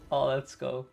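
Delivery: ordinary delivery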